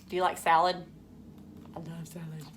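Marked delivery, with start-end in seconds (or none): 1.8-2.5 soft confiding whisper; a hushed, fond whisper. Real, not acted. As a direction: let them in on a secret. Confides love of salad.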